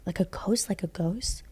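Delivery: imitating a softly whispered, incredulous question; hushed question. Cousin asks about the woman upstairs, hushed question. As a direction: drop to a near-whisper on a rising question.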